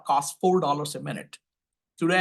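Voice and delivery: usual speaking voice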